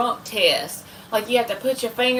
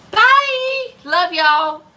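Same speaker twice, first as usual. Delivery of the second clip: bye pitched way up and loud; high sing-song bye; 9 semitones higher, 5 dB louder, much slower than usual. Real, not acted. Streamer signs off to viewers, high and loud. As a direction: squeak out a cheery bye. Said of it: "signing off".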